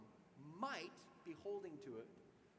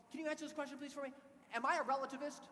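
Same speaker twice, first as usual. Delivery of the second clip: eager plea; 7 semitones higher, 6 dB louder than usual. Retold or imitated. Eager young man asks question.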